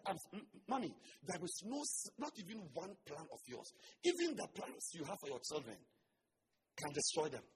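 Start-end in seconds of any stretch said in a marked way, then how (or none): none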